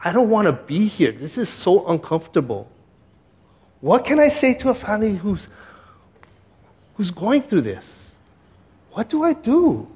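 high-pitched retold helplessness; reliving uneasy, overwhelmed thoughts; anxious inner voice. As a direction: plead inwardly, pitch climbing to the last question. Recalling panicked thoughts in waiting room.

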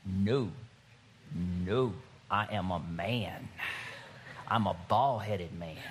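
low, mock-macho refusal; resisting emotion. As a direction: drop deep and insist on manly composure. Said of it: "I start getting emotional. I'm just like".

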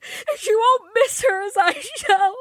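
put-on high, crying girl's voice, breaking; despairing sobs. A narrator's reading. Joe breaking down to Laurie over Beth, sobbing. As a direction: sob it out in despair. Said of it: "she cried despairingly".

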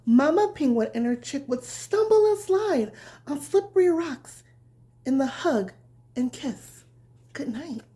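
bedtime story reading. A narrator's reading. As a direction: read it gently like tucking a child in. Reads bedtime story to children.

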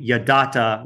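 yadata spelled out slowly, syllable by syllable; the Hebrew yadata drawn out for a class; slow Hebrew word. Shown as a demonstration. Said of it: "usually you would just say".

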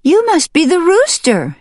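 high, loud hen's cry; excited high exclamation. Acted. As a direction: call out in sudden recognition. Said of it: "she cried".

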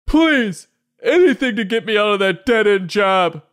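very high, loud begging voice; hammed-up pleading grown-up; desperate plea. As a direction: beg to escape the job. Adults begging to go to school.

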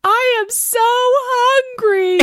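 very high, loud, drawn-out mock bawling; imitated sobbing wail. Aunt imitates hungry niece in the bath, hammed-up wail. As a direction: bawl it slowly like an overwrought small child. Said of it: "Just sobbing".

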